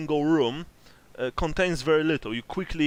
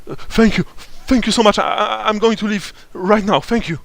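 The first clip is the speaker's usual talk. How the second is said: terrified; 6 semitones higher, 9 dB louder than usual. Acted. Relieved NPC thanking captors.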